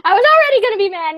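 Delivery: high, fast, loud venting; worked-up rapid complaint; mad. Retold or imitated. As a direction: rattle out indignant exasperation. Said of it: "Now I'm mad times two".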